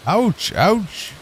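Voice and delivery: acted pain. Cake character getting poked.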